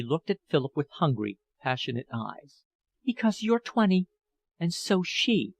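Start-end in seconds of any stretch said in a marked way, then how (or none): 3.0-5.5 higher, bitter, a touch hoarse; hoarse jealous lover. A narrator's reading. Jealous older woman reproaches young lover, higher and bitter. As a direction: throw his youth back at him. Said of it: "she said hoarsely".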